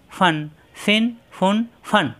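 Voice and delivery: each syllable ফান ফিন ফুন drawn out slowly. Shown as a demonstration. Teacher demonstrating to a student, ফান ফিন ফুন drawn out slowly. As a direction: stretch every syllable for the student. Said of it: "প্রত্যেকবার মাকড়াস ঠিক থাকবে".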